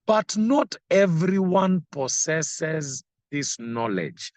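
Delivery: slow, lifted reading of the verse; scripture reading. Acted. Preacher reading a verse to the congregation, slow and lifted. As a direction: read the verse slowly and deliberately.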